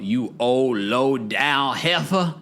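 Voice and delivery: put-on slow drawn-out old lady scold; Mama Joyce. Talking about Mama Joyce, a slow mock scold. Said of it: "That's what Mama Joyce said in the voice note".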